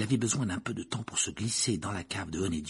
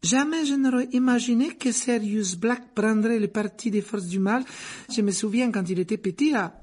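barmaid voice; 12 semitones higher, 4 dB louder than usual. A narrator's reading. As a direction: lift the voice high and serve the drinks sweetly.

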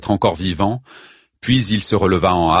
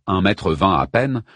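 ordinary delivery, nothing marked

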